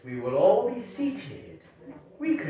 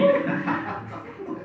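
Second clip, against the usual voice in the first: breathy laugh. Acted. Rukmi mocks the word taxing.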